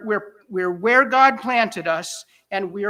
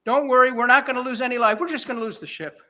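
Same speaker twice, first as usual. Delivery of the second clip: usual speaking voice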